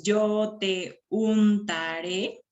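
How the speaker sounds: very slow, syllable by syllable; measured syllable-counting read. Shown as a demonstration. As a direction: break the line into separate drawn-out syllables. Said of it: "¿Cuántos hay ahí?".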